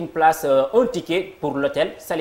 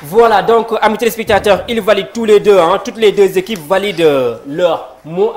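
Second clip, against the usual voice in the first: higher and louder, jubilant host; excited announcement; 5 semitones higher, 9 dB louder than usual. Real, not acted. Host tells viewers both teams scored, excited and loud. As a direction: celebrate the validation for the viewers.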